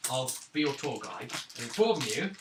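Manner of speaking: ordinary delivery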